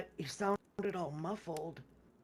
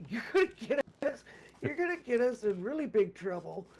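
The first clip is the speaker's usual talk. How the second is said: raised, urgent half-laughing scold; 5 semitones higher, 5 dB louder than usual. Retold or imitated. To teammates making noise in the shack, an urgent raised scold. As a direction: hush the noisy guys, pressing and high.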